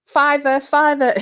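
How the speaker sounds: put-on high, loud drunken chant; tipsy call for tips; drunken cheer. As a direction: egg the crowd on for money. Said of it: "having a laugh and going".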